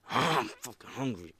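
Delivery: comic gruff aside. Pretend. Comic aside after victory speech.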